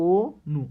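অনু as a loud, split "o... nu"; standard pronunciation, drawn out. Shown as a demonstration. Teacher repeats for learners, অনু broken into a long "o" and "nu". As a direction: stretch the rounded o of অনু, then "nu". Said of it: "আমরা বলি কি".

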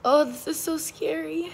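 ordinary talk

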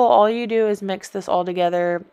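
ordinary talk, nothing marked

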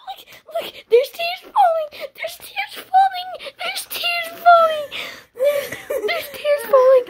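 high, loud tearful wail; hysterical, half-laughing sob; crying. Real, not acted. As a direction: squeal it through real tears. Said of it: "I'm crying".

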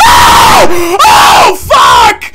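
high, loud screaming in terror; terrified screams. Real, not acted. Seeing the tall monster, screaming in real terror.

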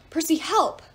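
loud, high plea; calling for help. A narrator's reading. Piper calls to Percy in panic, loud plea. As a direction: scream for help.

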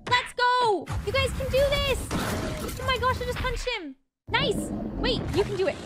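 usual speaking voice

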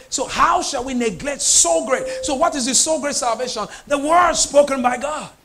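full-voiced pulpit declaration; impassioned preaching. Real, not acted. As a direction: proclaim it forcefully to the congregation.

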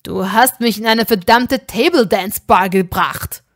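loud, furious reproach; furious at his friend over the strip club, loud snapping; angry hiss. Acted. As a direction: spit the words at him. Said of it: "fauchte er".

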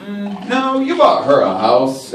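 ordinary talk, nothing marked

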